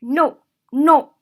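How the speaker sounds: loud "No, no" dropping sharply; full-stop "No," brooking no negotiation; commanding fall. Shown as a demonstration. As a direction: say "no" as a final order. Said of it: "There is no room for negotiation".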